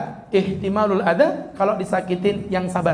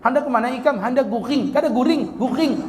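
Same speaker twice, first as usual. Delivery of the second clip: higher Banjar chatter with a weak R in "guring"; higher-pitched Banjar talk, R mushy; Banjar speech, soft R; 4 semitones higher than usual. Retold or imitated. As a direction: imitate a Banjar speaker swallowing the R. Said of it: "kalau ngomong R ... kurang cetok".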